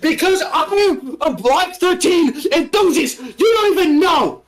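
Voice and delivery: high-pitched, slow, drawn-out yell; over-the-top yelled protest. Pretend. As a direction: shout it in mock outrage, way up high. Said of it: "I need to stop yelling".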